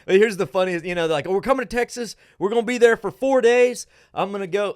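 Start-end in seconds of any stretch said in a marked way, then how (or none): 1.3-4.7 high, loud, gushing out-of-state visitor voice; mock-excited visitor planning a packed trip; excited tourist. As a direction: gush like a tourist who's far too eager. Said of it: "they don't talk like this".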